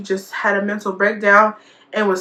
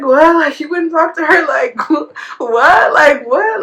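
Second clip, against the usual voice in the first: high, loud retold "what?" breaking into a laugh; laughing disbelief; 6 semitones higher, 4 dB louder, slower than usual. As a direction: tease her with amused incredulity. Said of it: "and I was laughing".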